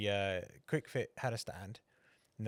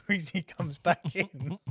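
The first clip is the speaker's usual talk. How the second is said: slow, higher, louder words broken by laughter; laughing through it; 7 semitones higher, 7 dB louder, slower than usual. Real, not acted. Telling his co-host the sneaking story, laughing. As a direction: chuckle as you land the punchline.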